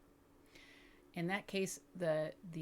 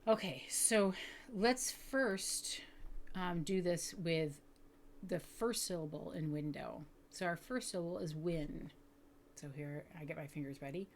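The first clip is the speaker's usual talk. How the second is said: ordinary delivery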